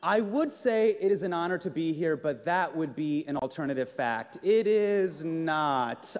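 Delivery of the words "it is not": dragged-out flat denial; deadpan, very slow. Acted. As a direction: let each word drop slowly.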